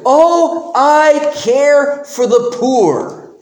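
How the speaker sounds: high, slow, put-on caring tone; hammed-up self-righteous sigh; mock pious whine. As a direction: sneer at fake charity. Preacher mocks Judas's fake piety.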